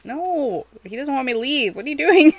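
ordinary delivery

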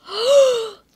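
loud startled gasp; sharp gasp. Real, not acted. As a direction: gasp hard, as if just escaping. Near miss in the game.

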